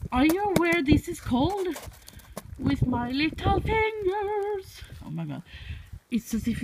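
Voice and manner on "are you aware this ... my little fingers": playful complaint. Real, not acted. Telling how cold it is with little fingers, playful high complaint. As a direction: whine playfully, stretching the last words.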